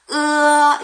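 으 sliding into 어 on a high level tone; high, held 으 opening into 어; two vowels linked. Shown as a demonstration. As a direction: join 으 and 어 in one long sound. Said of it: "두 가지를 연결하시는 거예요".

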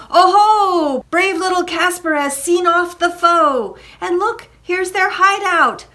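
high, loud, triumphant call; joyful holler. A narrator's reading. Jack cheers Casper for chasing the foe, joyful holler. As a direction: crow over the victory. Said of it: "Jack cried".

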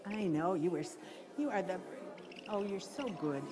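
low, soft praise to an animal; tender cooing. Real, not acted. Handler praising her lovely owl as she puts it away, soft and tender. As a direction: coo it gently, drawing out the last words.

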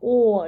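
emphatic, sustained "О"; isolated vowel. Shown as a demonstration. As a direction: sound out a long "О". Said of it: "Наступний звук".

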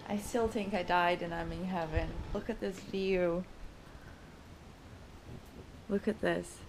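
soft, quiet wonder; hushed awe. Real, not acted. Guest admiring the morning view, hushed soft wonder. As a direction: murmur to yourself in awe.

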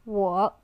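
我 said in Mandarin third tone, low and dipping. Shown as a demonstration. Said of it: "the third tone as well".

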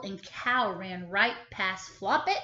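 ordinary delivery

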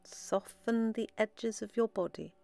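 soft, unhurried meditation voice with long pauses; guided meditation. Acted. Guide leading listeners into relaxed breathing, soft meditation voice. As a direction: guide them gently inward, softly, phrase by phrase.

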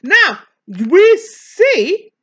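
high, loud and very slow, each word stretched; drawn-out emphasis. Real, not acted. Preacher stretching the point for the class, loud and slow. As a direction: lean hard on every word to make the point.

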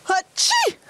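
loud sneeze. Acted. Fake sneeze again.

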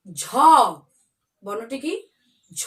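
usual speaking voice

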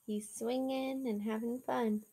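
ordinary delivery

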